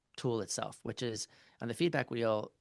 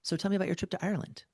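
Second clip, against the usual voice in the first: higher, fast, sweet chatty voice; chirpy wife quote; 9 semitones higher, faster than usual. Retold or imitated. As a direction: mimic her breezy friendly question, fast. Quoting wife, chatty to friend.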